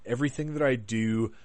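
ordinary talk, nothing marked

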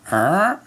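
rising imitation of a creaky stair; creaking step noise. Boy denies it then steps on the stair, imitated creak. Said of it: "it just goes".

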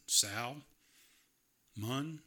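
Sal, then Mun, in separate syllables; sounded out by spelling. Shown as a demonstration. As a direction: break it as Sal, long pause, Mun. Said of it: "it's spelled S A L M O N".

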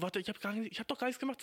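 mock wronged-kid objection; protesting child. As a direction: plead your innocence to the teacher. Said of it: "dass es ungerecht ist".

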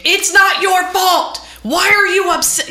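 slow, high-pitched imitation yell; mock yelling. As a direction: holler it like an exaggerated scolding. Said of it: "if you start yelling at her".

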